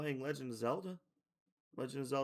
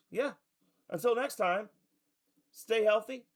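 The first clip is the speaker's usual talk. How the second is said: loud cheerful sign-off; 9 semitones higher, 7 dB louder than usual. Real, not acted. Loud stream outro.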